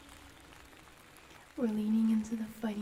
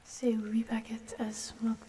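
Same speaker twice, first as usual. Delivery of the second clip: put-on French accent whisper. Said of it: "with the French accent".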